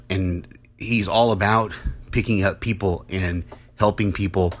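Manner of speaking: normal talking voice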